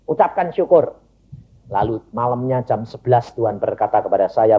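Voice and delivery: normal talking voice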